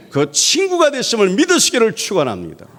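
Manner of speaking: 1 intense preaching. Acted. Repeated blessing, much higher.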